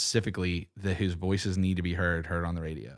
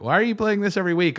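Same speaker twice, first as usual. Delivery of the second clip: mock incredulous listener; 12 semitones higher, 7 dB louder, faster than usual. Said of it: "some people are like".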